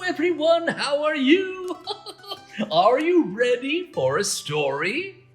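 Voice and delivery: ordinary delivery, nothing marked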